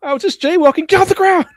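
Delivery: high, loud mock police stop; mock screaming. Joking about police going straight to ten, mock screaming. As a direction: cry it out high and frantic, hammed-up.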